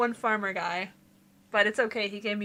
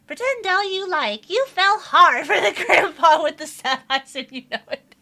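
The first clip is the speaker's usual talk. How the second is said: lightly voiced woman, higher and louder, teasing; teasing village woman; 7 semitones higher, 7 dB louder than usual. A lightly voiced village woman teasing the newcomer, mild mocking. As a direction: rib him about the sad old man.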